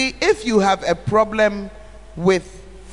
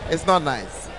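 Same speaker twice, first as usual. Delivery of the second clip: ordinary delivery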